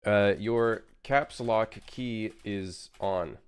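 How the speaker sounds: very slow, spelled-out delivery; slow and deliberate. Shown as a demonstration. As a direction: say it slowly and carefully. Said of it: "let me say it in Spanish".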